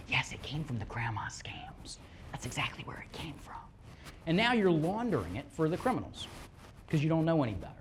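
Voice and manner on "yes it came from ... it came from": conspiratorial whisper to the audience. Acted. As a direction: whisper it like a secret. Confiding answer to the audience.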